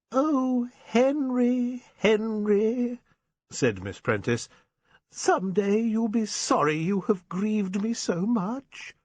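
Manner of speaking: high, pleading spinster voice, slowing at the end; a wounded old spinster reproaching him; Miss Prentice. Acted.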